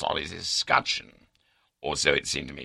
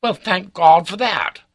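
loud, high, sneering cry; sarcastic; 11 dB louder than usual. A narrator's reading. Baronet to his doubting confidant, sarcastic. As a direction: exclaim with heavy mock relief. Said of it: "cries he sarcastically".

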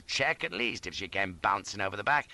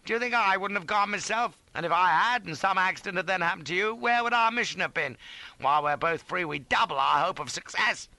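raised, forceful argument; stern reproof; 9 semitones higher, 4 dB louder than usual. A narrator's reading. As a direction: lecture him as duty personified. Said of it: "stern duty personified".

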